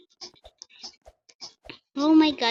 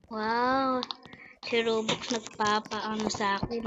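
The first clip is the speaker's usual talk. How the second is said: normal talking voice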